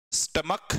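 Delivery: स्टमक said as "stum-uk", ch sounding like k; स्टमक as "stumuk", no ch sound; correct pronunciation. Shown as a demonstration. Teacher to students, showing स्टमक spoken with a k sound. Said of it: "इसको बोलते क्या?".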